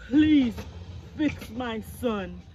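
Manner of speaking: loud, slow insistent plea; demanding father. Acted. Father demanding the doctor fix his son. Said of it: "demanded Crispin's father".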